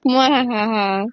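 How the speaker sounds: slow mock evil laugh; hammed-up villain cackle; sneering panto villain. Villain mentioned.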